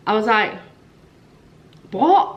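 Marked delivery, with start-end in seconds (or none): 1.9-2.3 sudden high, loud cry of disbelief; shocked what. Retold or imitated. To the midwife, stunned at one centimetre, a shocked yelp. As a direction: blurt it out, stunned by the news.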